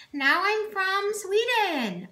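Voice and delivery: put-on high, slow Swedish-Danish accent; a silly Scandinavian accent joke; mock Swedish accent. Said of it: "that was a Danish accent".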